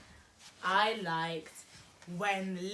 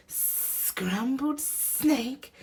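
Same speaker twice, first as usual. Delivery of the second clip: slow, high-pitched shocked question; alarmed snake; 8 semitones higher, much slower than usual. Acted. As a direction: draw it out slowly in snake-like alarm. Snake alarmed at being food.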